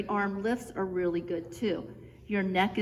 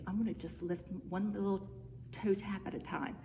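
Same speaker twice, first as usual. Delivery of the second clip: softer, whispering to yourself; whispered thought; 8 dB quieter than usual. Pretend. Instructor voices the viewer's thought, soft whisper. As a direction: voice the thought under your breath. Imagined inner thought while exercising.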